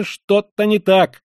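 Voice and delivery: high, loud, slowing on the repeat; heated exclaim. A narrator's reading. Young man heatedly insists something is wrong, to Koch. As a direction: press the point hotly. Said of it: "горячо вскричал молодой человек".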